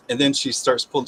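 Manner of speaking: normal talking voice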